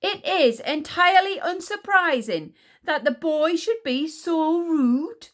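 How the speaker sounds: high, loud indignant queen; raging. A narrator's reading. Queen offended by the rude boy, raging. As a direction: rage regally, drawing out the end. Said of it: "raged Queen Sycorax".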